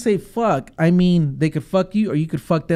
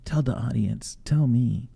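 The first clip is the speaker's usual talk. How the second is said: hushed teasing whisper; 4 semitones lower, 9 dB quieter than usual. Acted. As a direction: drop to a whisper. Host whispers teasing aside.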